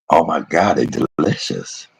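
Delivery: ordinary delivery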